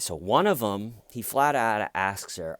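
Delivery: ordinary talk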